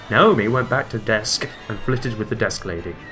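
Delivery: ordinary talk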